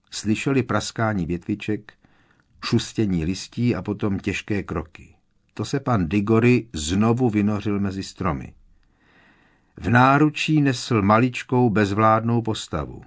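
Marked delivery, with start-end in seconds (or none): none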